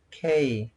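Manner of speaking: K repeated as "kay"; English K. Shown as a demonstration. Teacher repeating the English letter, K said as "kay". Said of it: "In English, you pronounce".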